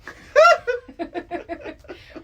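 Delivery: loud high laugh; big laugh. Real, not acted. Host laughs at guest asking if she looks okay, loud laugh.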